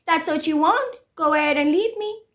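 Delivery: high-pitched retort in mimicry; imitated defiant dismissal. She tells him to leave her, mock defiant. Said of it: "And she said".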